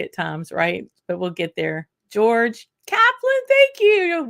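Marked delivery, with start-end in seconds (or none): 2.1-4.3 much higher, louder, slowed name call; light up as a friend's name pops into chat; excited greeting. Real, not acted.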